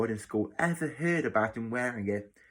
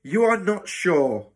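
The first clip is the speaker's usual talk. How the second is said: raised, higher-pitched incredulous echo; loud; 7 semitones higher, 7 dB louder than usual. Acted. Matron humiliating the boy before the ward, loud. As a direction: repeat it loudly for all to hear. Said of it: "said Matron loudly".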